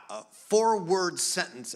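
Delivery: usual speaking voice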